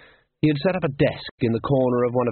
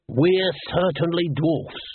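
higher, slow, trying the phrase out; mulling it over like a slogan; Dibbler voiced; 6 semitones higher, slower than usual. A narrator's reading. Dibbler turning the dwarf's protest into a band name, slow and higher.

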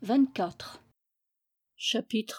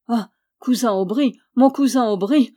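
louder, rising aggrieved grumble; grumbling; 3 semitones higher, 7 dB louder than usual. A narrator's reading. Outwitted knight reproaches his cousin, loud grumbling complaint. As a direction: build the reproach on the repeat. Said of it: "grommela le pauvre chevalier".

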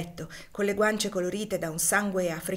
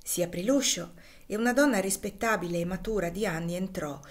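ordinary delivery, nothing marked

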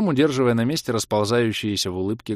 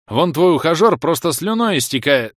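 higher, louder jab; teasing; 7 semitones higher, 7 dB louder than usual. A narrator's reading. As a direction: needle her about the suitor. Narrator teasing ex-wife. (с подколкой)

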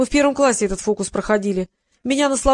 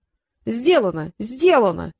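drawn-out "Сделано", then said once more; evasive assurance; slower than usual. A narrator's reading. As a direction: insist slowly that it's done, dodging the point. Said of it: "продолжал юлить Китап".

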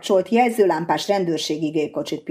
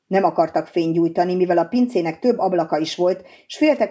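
ordinary delivery

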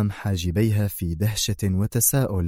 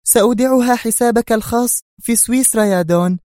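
high, loud woman's voice, irritated; annoyed Sonia; 14 semitones higher, 5 dB louder than usual. A narrator's reading. Sonia answers the Don's silly question, annoyed. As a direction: answer as if it's obvious. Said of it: "أجابت في حنق".

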